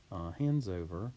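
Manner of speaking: ordinary talk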